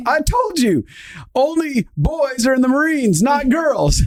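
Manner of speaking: high, laughing mock insistence; imitating old man. Old man insisting to his wife, high laughing voice.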